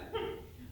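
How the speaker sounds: a quick, light chuckle; short chuckle. Acted. Sailor chuckles to the audience about free land. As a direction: chuckle at his own good luck.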